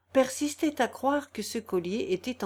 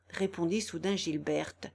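plain reading voice

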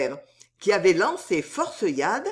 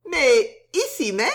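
higher-pitched maid's voice; soubrette; 5 semitones higher, 4 dB louder than usual. A narrator's reading. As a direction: answer him in a high feminine voice. Soubrette answers her suitor. (voix aiguë de soubrette)

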